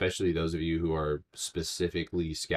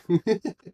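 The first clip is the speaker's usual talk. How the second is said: high laugh; 5 dB louder than usual. Real, not acted. Laughs at the joke.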